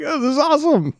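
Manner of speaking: very high, playful squeal of delight; retold excitement. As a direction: act out catching someone else's excitement. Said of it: "I'm getting excited because he's so excited".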